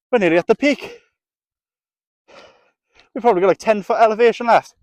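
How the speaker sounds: high, loud thrilled talk; excited. Real, not acted. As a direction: gush about the view. Thrilled at reaching the peak.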